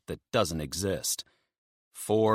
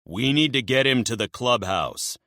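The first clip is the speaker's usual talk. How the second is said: firm interruption; 6 semitones higher, 5 dB louder than usual. Acted. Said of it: "Magnus interrupts".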